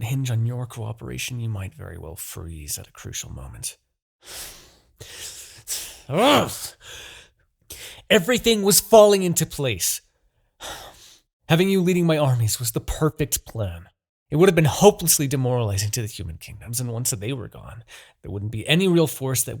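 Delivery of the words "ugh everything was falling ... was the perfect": a high, loud groan of frustration; frustrated outburst. Acted. Demon king shouting at his captive over the ruined plan. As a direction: vent the ruined plan in one burst.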